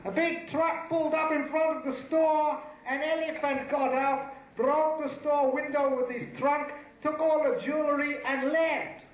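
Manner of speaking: high-pitched comic victim's account. Acted. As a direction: pour out the story in a high, worked-up voice.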